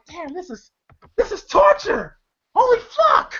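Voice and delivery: high-pitched appalled exclamation; horrified reaction. Retold or imitated. Viewer reacting to the torture scene, high and appalled. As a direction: exclaim in comic horror, way up high.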